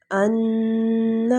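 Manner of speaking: ان held, nasal ghunna. Shown as a demonstration. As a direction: hum ان long through the nose. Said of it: "ناک کے بانسے میں لے کر جانا ہے".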